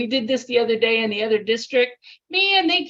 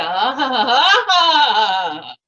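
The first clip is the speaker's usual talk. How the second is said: loud hearty laugh; demo audience laugh; 2 semitones higher, 7 dB louder, much slower than usual. Shown as a demonstration. Speaker demonstrates the laugh the audience gives, laughing loudly. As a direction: laugh out loud to show the crest. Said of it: "add a facial gesture that makes everybody laugh again".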